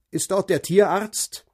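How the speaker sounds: loud, wide-awake phone voice; ring modulator. A narrator's reading. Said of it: "Eine metallische Stimme, energisch und hellwach". (metallische, energische Telefonstimme)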